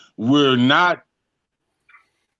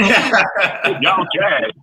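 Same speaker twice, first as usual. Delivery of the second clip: lightly voiced, higher impression with laughter around it; 4 semitones higher than usual. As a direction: repeat the teasing impression, a bit higher.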